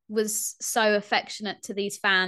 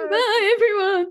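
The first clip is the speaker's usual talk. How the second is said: high squeaky laughing goodbye; helium voice; 12 semitones higher, 8 dB louder than usual. Acted. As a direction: squeak it out through giggles. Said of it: "my voice is so".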